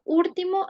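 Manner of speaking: "último" said as "úrtimo", a tapped R in place of the L; Caribbean "úrtimo" for "último"; L turned to R. Shown as a demonstration. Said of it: "conversión de la L en R".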